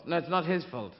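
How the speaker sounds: usual speaking voice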